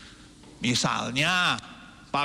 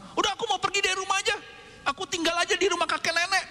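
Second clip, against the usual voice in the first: put-on much higher, fast huffy child voice; sulky threat; 10 semitones higher, faster than usual. Angry child threatening to move to grandparents, high and fast. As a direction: storm off like a sulking kid. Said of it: "Jangan kita marah-marah".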